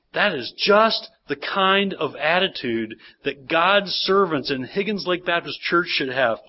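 ordinary talk, nothing marked

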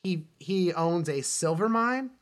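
normal talking voice

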